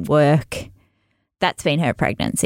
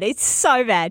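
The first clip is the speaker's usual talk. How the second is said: ordinary talk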